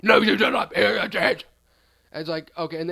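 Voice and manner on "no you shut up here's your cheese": loud, rough abusive tirade; hammed-up furious sandwich-guy rant; nasty yelling. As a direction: scream at the customer like a nasty little monster. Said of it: "a really nasty little monster".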